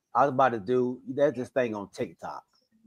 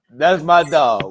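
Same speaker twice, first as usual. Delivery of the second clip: slow and louder, every word of "That's my dog" stretched out; drawn-out emphasis; 2 semitones higher, 9 dB louder, much slower than usual. Real, not acted. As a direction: lean on "my dog", slow and proud. Repeats the phrase.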